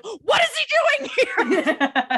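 imitating stern Din. Imitating Din asking Peli about the baby, slow, high and half-laughing. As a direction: drag out the question with mock outrage. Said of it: "turns to Peli and is like".

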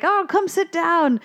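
lightly acted welcoming dentist; inviting dentist. Dentist inviting the girl to sit, welcoming.

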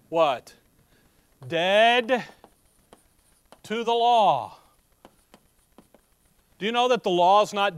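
loud, emphatic preacher driving the verse home; forceful preaching. Real, not acted. Preacher expounding scripture to the believers, loud and emphatic. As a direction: preach it loudly, leaving weighty pauses.

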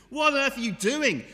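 high, loud, incredulous cry; gasping disbelief. Acted. Friend fleeing a bear, gasping in shocked disbelief. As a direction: gasp it out in alarm at the friend. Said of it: "gasps at him and says".

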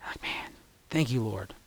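hushed, heartfelt thanks; a private whisper of thanks; whispered prayer. Real, not acted. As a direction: breathe it out to God. A quiet prayer of gratitude.

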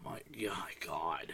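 hushed, breathy my God; whispered dismay. Real, not acted. Fan whispering to friend about the game, hushed dismay. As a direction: whisper it in disbelief.

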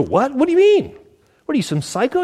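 a sudden high-pitched yelp of shock; a patient balking in alarm at the doctor; alarmed disbelief. Acted. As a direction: recoil at the doctor's words. Patient reacting to surgery news.

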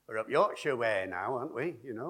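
usual speaking voice

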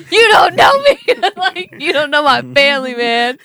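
high, loud laughing sob; mock crying wail. Telling boyfriend her cousin Iron Man died, laughing wail. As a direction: wail it like a tripping mourner half laughing. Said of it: "And I'm, like, crying".

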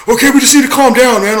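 high, loud whiny mimicry of the boss; mocking the boss. Retelling a fight, mocking the boss's high loud plea. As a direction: squeak it out like a panicky coworker. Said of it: "and he's like".

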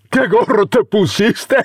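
high cackling, jeering laugh; mocking laughter. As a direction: laugh at the silly hat. Mocking drunk youth laughs.

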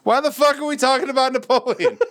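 very high, exasperated question; mock outrage. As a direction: demand it in squeaky pretend fury. Mock outraged quiz answer.

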